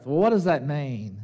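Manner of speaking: usual speaking voice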